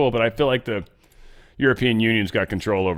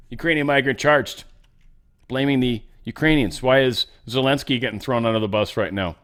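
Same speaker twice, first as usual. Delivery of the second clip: normal talking voice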